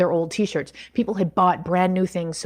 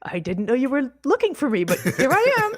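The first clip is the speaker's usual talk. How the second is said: high, laughing retold relief, pitch breaking up; laughing delight; 8 semitones higher than usual. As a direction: laugh through the words as if caught off guard with joy. Retold joyful phone surprise.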